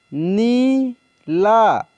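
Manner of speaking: Neela drawn out very slowly, sound by sound; slow dictation of Neela; slow spelled-out reading. Shown as a demonstration. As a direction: stretch Neela for the learners. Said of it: "teacher reads word slowly".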